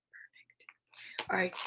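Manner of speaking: a breathy whispered echo. Acted. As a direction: whisper the word back. Whispered repeat.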